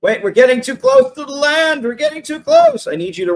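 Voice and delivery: high-pitched mock alarm; acted high, urgent cry; panicked warning. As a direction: warn the crew in a rising panic. Acted alarm at nearing land.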